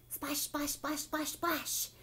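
brisk, voiced splash sounds in a row; quick splashes. A narrator's reading. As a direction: rattle off the splashes like fast oars. Fast rowing, sound effect.

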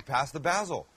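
basil said as BAZ-il; short flat A in basil, rhyming with "dazzle"; Canadian pronunciation. Acted. Cook repeating the crew's joke, Canadian pronunciation of basil. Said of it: "as the Canadians would say".

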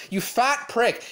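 usual speaking voice

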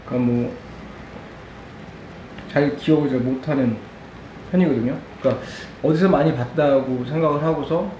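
chewing between words; talking mid-bite; mouth full of noodles. Real, not acted. Seen 0: Takes chopstick bite at 0.2, then speaks with food in mouth.

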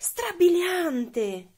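high, very drawn-out wonder; storybook amazement; amazed exclamation. A narrator's reading. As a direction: stretch it out in awe to flatter. Princess marvels at dragon's fire.

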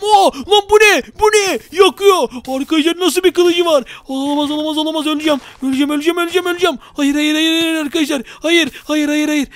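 high, loud frantic panic; getting hit by a fire sword, high frantic panic; panicked. Real, not acted. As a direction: scream out the dread of dying. Attacked by a burning sword.

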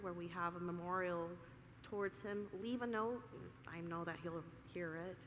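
mock solemn. Presenter fakes a solemn memorial for the crowd, quiet and mock-grave. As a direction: play it as a fake eulogy, softly.

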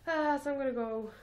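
ordinary delivery

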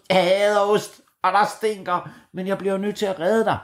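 high, chirpy little bird voice; bright tiny hero voice; brave blue tit. A narrator's reading. The blue tit calls Bamse to hop on, high and eager.

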